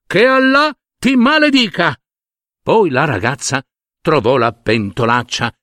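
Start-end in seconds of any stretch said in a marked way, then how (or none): 0.0-2.0 high, shouted curse flung after her; a tree yelling a curse at a rude girl; cursing shout. Acted. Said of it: "e le gridò dietro".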